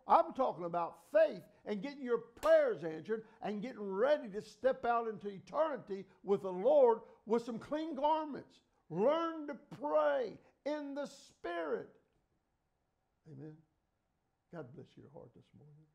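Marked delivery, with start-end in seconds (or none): 0.1-11.9 high, loud preacher's cry building to a slow peak; fiery revival preaching, drawn out at the end; preaching at full force. Acted. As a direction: drive it home to the congregation. Preaching climax.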